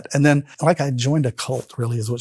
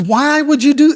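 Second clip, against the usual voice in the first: loud, much higher-pitched imitation of a yell; retold upset yelling; 13 semitones higher, 8 dB louder than usual. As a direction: imitate her shrieked, appalled question. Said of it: "she started yelling and s-, getting upset".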